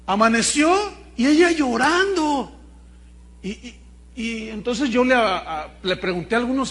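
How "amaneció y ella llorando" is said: louder, higher preaching; preaching emphasis. Acted. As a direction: proclaim it with force.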